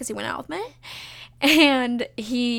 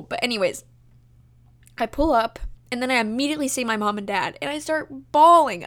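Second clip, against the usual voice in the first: ordinary delivery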